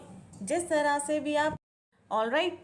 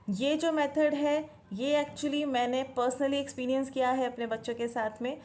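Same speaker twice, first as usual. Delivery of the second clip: ordinary talk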